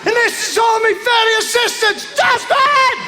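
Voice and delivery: fairy godmother. Pretend. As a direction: ham up a shrieking fairy godmother announcing herself. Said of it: "I could never be a fairy godmother".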